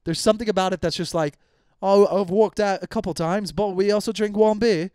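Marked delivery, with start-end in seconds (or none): 1.8-4.9 higher, badly faked British accent; terrible British accent. Comic mimicking British women, deliberately bad British accent. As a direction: put on a hammed-up, awful British voice. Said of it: "That's also the worst accent ever".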